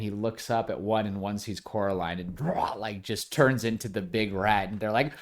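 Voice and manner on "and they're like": ordinary talk, nothing marked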